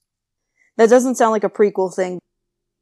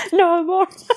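laughing quote; 8 semitones higher, 4 dB louder than usual. Real, not acted. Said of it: "laughing while quoting".

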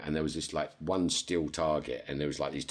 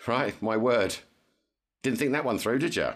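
mock scolding; 6 semitones higher, 4 dB louder than usual. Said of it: "I said".

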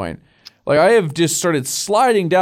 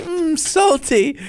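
high, slow, relishing "salty"; savoring mock taunt; 12 semitones higher, much slower than usual. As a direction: savor the word slowly, up high. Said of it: "He's like".

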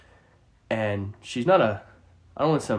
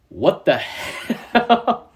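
drawn out slowly, higher, cracking into laughter; laughing disbelief; 5 semitones higher, much slower than usual. Retold or imitated. Storyteller recalls learning the grill was tossed, laughing disbelief. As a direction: retell your disbelief and lose it laughing.

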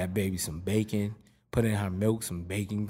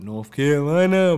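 louder, higher North Carolina twang; North Carolina accent; 5 semitones higher, 10 dB louder than usual. Acted. Showing how North Carolina boys talk, proud local accent. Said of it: "they talk normal".

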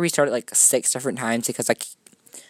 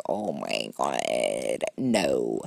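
much lower, very slow put-on groan; mock dramatic dismay; 12 semitones lower, much slower than usual. Hearing Shane is in a controversy, low slow mock dismay. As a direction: drag it out like a tragic reaction. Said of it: "I'm like".

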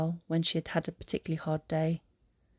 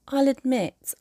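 high, louder lilting admission; admitting heroine; 8 semitones higher, 6 dB louder, slower than usual. A narrator's reading. She owns up to reading about his family, high admitting voice. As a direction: lift the voice into a confession. Hermione confesses her curiosity.

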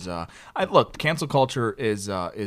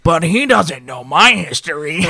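loud, slow, higher punchline voice; hammed-up lady; 5 semitones higher, 12 dB louder than usual. Lady confiding her secret, loud drawn-out imitation. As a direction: deliver the lady's confession as a big mock reveal. Lady's gleeful punchline, acted.

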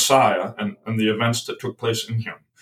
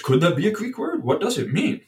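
puzzled wondering; 7 semitones higher than usual. Retold or imitated. Retold inner wondering about the word.

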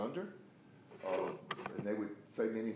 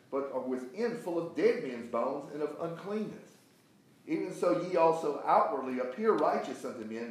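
higher-pitched preacher reading, swelling on stressed words; emphatic scripture reading; 3 semitones higher, 4 dB louder than usual. Acted. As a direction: read the rebuke out with force. Reads scripture forcefully to class.

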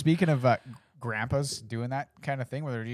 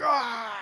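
put-on kid roar; 11 semitones higher, 4 dB louder than usual. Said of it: "he's like".

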